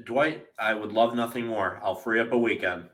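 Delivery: usual speaking voice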